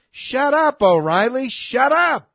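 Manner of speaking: a high, loud shout of shut up at O'Reilly; exasperated yell. Real, not acted. Yelling at O'Reilly.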